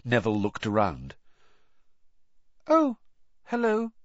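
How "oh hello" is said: very high, unnatural greeting; squeaky, nervous hello; much higher than usual. A narrator's reading. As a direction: greet them far too high. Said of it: "his voice much higher than usual".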